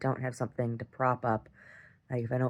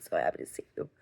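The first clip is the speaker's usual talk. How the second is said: tearful; 10 semitones lower, 5 dB quieter, faster than usual. Retold or imitated. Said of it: "And I'm crying".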